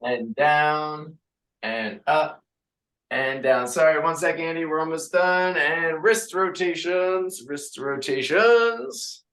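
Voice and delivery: upbeat class count, sung out; fitness instructor calls. Acted. Instructor counting reps for the class. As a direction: lead the class through reps. Leading cooldown and stretch.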